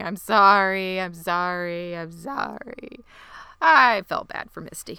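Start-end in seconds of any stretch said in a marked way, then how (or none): 0.0-3.1 drawn-out mock tearful apology; plaintive retold crying; imitated sobbing. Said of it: "sobbing. She's saying".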